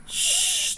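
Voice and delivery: long hushing "shh"; shushing. A narrator's reading. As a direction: hush the listeners softly. Hushing before the spider refrain.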